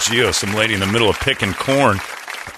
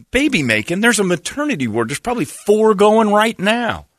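ordinary talk

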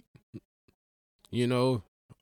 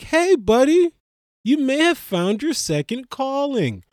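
much higher and louder, cheery fan voice; mock praising fan; 9 semitones higher, 3 dB louder than usual. Comic mocking a fan who praises athlete rappers, high and bright. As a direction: ham up a gushing fan. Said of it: "sound like".

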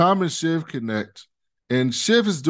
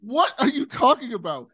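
much higher, incredulous "What the fuck?"; retold shock; 11 semitones higher than usual. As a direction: jump up in pitch as if you can't believe it. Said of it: "I'm like".

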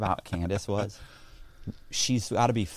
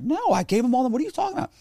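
incredulous protest; faster than usual. Retold or imitated. Indignant denial to agents.

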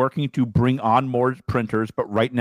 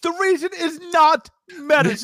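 outraged squeal of denial; high-pitched protest; 4 dB louder than usual. Real, not acted. Rebutting the co-host about Metazu, high-pitched protest. Vehement joking denial of co-host's claim.

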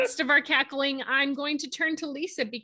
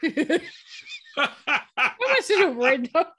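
laughing; 5 semitones higher, 5 dB louder, slower than usual. Real, not acted. Laughing at Philip's answer.

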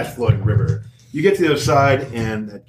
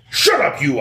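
angry ogre; 3 semitones higher, 3 dB louder than usual. Acted. Ogre bashing the kicking sack, angry yell. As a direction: snap it as an ogre scolding its captive.

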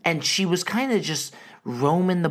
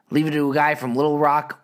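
ordinary delivery

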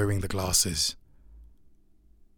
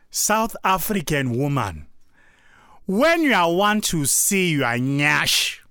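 Nigerian accent imitation; 5 semitones higher, 3 dB louder than usual. Retelling a funny Nigerian guy's TikTok, Nigerian accent. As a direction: do the TikTok Nigerian guy, amused and loud. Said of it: "he says something like this".

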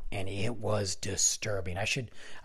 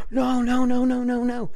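high, loud string of frantic noes; urgent pleading; 12 semitones higher, 5 dB louder than usual. Retold or imitated. Buddy running over to stop a fight, urgent. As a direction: imitate a panicked friend rushing in.

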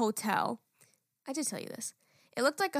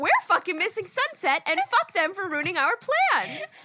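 higher, louder mock rant; retold angry rant; 8 semitones higher, 6 dB louder than usual. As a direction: vent it like you're fed up, the last words slowed.

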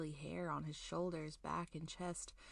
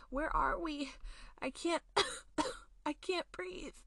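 put-on much higher, louder, gasping boy; choking panic; 9 semitones higher, 4 dB louder than usual. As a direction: choke out the words in panic.

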